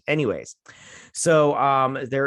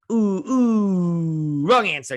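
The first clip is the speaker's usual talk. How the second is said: loud, high buzzer-style gloating; hammed-up quizmaster relishing a wrong answer; mock game-show host; 7 semitones higher, 6 dB louder, much slower than usual. Mimics villain running quiz with shocks.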